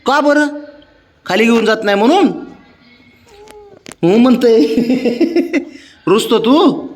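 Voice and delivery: put-on high coaxing baby talk with little laughs; pet baby talk. To the sulking pet Babya, high teasing baby talk.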